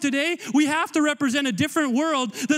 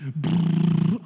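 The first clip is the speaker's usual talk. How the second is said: shaking noise; 7 semitones lower than usual. Retold or imitated. Chains dropping off mockingly.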